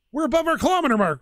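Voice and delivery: high, gasped realisation; suddenly notices the distance; real pleased surprise; gasping surprise. Real, not acted. Gasps at reaching the goal.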